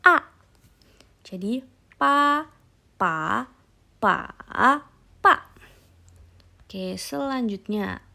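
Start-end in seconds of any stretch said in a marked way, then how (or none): 0.0-0.3 short falling "A"; fourth tone. Shown as a demonstration. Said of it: "turun dari atas ke bawah". 1.9-5.3 "pa" high level, rising, dipping, then falling; four tones in turn. Shown as a demonstration. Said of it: "nada satu datar".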